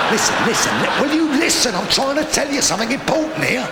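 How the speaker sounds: high, loud mock-indignant plea for attention; exasperated shout. Shouts over the laughing audience.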